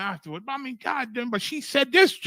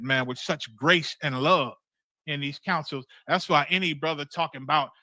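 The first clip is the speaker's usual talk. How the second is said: normal talking voice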